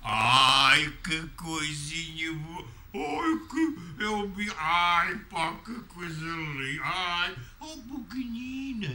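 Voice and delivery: drawn-out tender cooing to a tiny cat; slow, high pet talk, melting over the kitten. Real, not acted.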